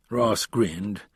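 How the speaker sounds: ordinary delivery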